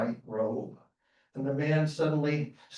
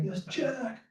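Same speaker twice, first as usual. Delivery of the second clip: put-on high, soft frail old man's reply; a starving head barely able to speak; weak old man; 8 semitones higher, 5 dB quieter than usual. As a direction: answer faintly from far down in the grass.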